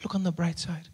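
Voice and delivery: soft, feeble reassurance; calm a crying child quietly; gentle hushed mother's voice; soothing mother. Acted. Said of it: "And she says".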